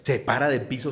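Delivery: normal talking voice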